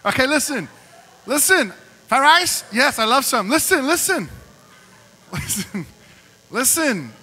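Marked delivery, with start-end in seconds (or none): 0.0-3.2 high, loud mom greeting; hammed-up cheery hello. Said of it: "his mom's like".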